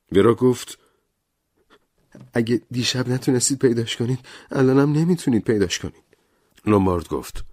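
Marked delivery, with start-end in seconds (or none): 2.3-6.0 higher, urgent woman; Vera's voice. Acted. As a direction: she argues quickly, higher. Vera voiced. (صدای ورا، زیرتر و تند)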